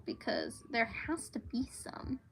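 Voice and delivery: soft whisper to herself; whispered aside while searching pages. Real, not acted. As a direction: breathe it out under her breath.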